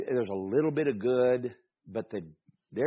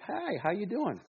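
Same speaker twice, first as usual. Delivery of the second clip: high-pitched mock greeting; imitated window greeting; 10 semitones higher than usual. Customer mimicking the Wendy's window worker, high put-on greeting. Voicing person at drive-through window.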